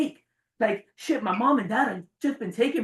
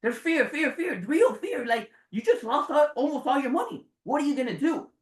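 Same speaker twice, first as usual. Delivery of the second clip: fearful inner voice; 5 semitones higher than usual. Retold or imitated. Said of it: "this little voice in my head saying".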